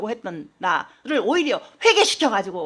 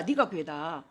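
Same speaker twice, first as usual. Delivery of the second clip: softly repeated; 8 semitones lower, 11 dB quieter than usual. Shown as a demonstration. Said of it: "그렇게 된 거예요".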